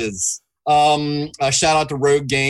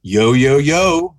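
loud greeting; 8 dB louder, slower than usual. Real, not acted. Shouted greeting.

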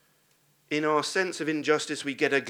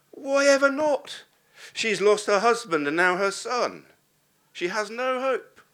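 fervent preaching; 10 semitones higher, 8 dB louder than usual. Acted. Preacher pleading the widow's hopeless case, loud and fervent. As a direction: preach it with full passion.

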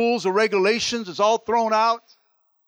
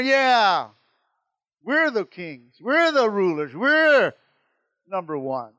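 smug boasting; 4 semitones higher, 7 dB louder, slower than usual. Acted. Mocking rulers boast.

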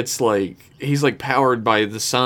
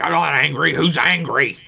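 retold touchy denial, pitched up; mock angry denial; 5 semitones higher than usual. Riker answering Troy in the corridor, mock angry. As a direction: imitate someone angrily insisting otherwise. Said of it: "You seem very angry".

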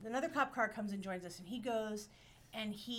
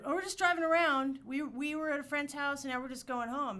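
higher, louder, breezy innocence; fake innocence; 5 semitones higher, 7 dB louder than usual. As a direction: play it totally innocent. Innocent excuse to cop.

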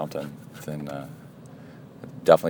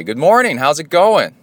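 bright, higher, louder good morning; cheerful greeting; 8 semitones higher, 5 dB louder than usual. Retold or imitated. Waving at the angry neighbor who won't look, cheerfully. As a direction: greet him cheerfully to disarm him. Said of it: "Like that".

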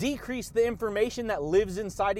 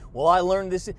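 usual speaking voice

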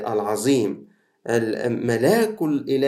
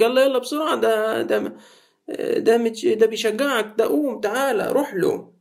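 high, hurried coaxing; the crowd now hurrying him along; high excited encouragement; eager urging; 11 semitones higher than usual. Acted. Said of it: "يقول لك".

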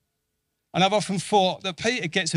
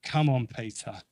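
soft, low, coaxing call; gentle call; 4 semitones lower, 6 dB quieter than usual. Retold or imitated. Jesus coaxing Peter to step out, softly. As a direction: draw him in quietly. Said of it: "he's saying".

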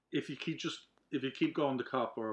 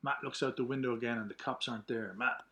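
ordinary talk

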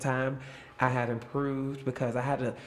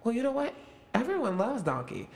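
cheerful pep talk; 7 semitones higher than usual. Retold or imitated. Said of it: "And then I was like".

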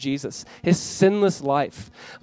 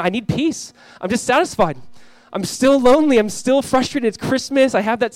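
higher-pitched mock complaint; voiced discontent; 8 semitones higher, 5 dB louder than usual. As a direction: voice restless people pleading their lack, pitched up. Voicing dissatisfied people in sermon.

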